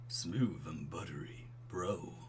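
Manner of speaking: low, slow, soft bro voice; smooth and buttery. Shown as a demonstration. Streamer trying out Craig's voice, smooth and buttery. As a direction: pour it out low and unhurried. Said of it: "I can do smooth and buttery".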